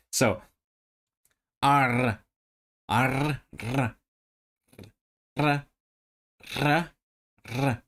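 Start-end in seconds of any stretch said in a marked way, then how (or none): 1.6-7.8 repeated attempts at rolling R; trilled R. Shown as a demonstration. Learner struggling with a native sound, trilled R. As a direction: try to roll each R. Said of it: "even do a thing that native speakers do".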